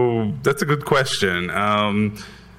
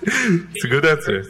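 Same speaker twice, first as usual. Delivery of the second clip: laughing; 7 semitones higher than usual. Real, not acted. Laughing at own joke.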